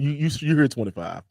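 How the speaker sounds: ordinary delivery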